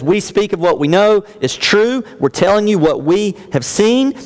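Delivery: a touch of urgent conviction; emphatic. Acted. Voicing Jesus emphatically.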